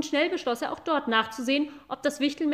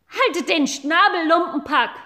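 angry shout; 5 semitones higher, 8 dB louder than usual. Acted.

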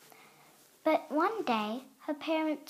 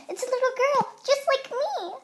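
much higher and louder, thrilled; excited; 11 semitones higher, 8 dB louder, faster than usual. Acted. Noah meets baby sister, thrilled and much higher. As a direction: gush it the second time round.

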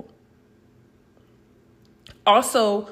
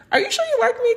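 incredulous high question; much faster than usual. Retold or imitated. To a guy who never showed it, high disbelieving question. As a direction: voice jumps way up, doubting. Doubting quote to a guy she dated.

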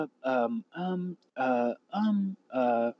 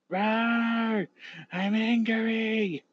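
high, slow cartoon monster rawr; deep distorted monster roar; 9 semitones higher, much slower than usual. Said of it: "I have an audio clip for it".

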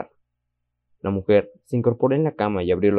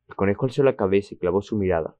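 ordinary delivery

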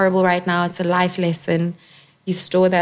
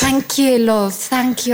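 cheeky London thank you, love, a little higher; gaudy London accent; 4 semitones higher, slower than usual. Pretend. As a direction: ham up a cockney barmaid. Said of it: "It's so great".

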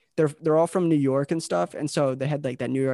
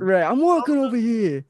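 loud, higher New York grump; mock New York accent; 9 semitones higher, 6 dB louder than usual. Host joins the deli bit in a mock New York accent. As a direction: play up a cranky New York worker. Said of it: "especially talking in that accent".

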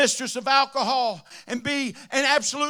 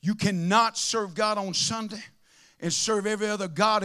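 ordinary talk, nothing marked

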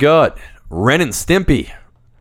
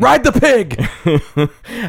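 high, stretched-out cartoon cry; cartoon character catchphrase; 10 semitones higher, much slower than usual. Host quoting Zim and Gir, high cartoon catchphrase. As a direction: squeal it slowly like a manic cartoon.

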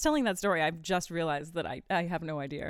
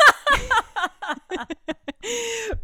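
loud laugh; 10 dB louder than usual. Real, not acted.

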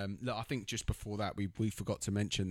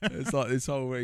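normal talking voice

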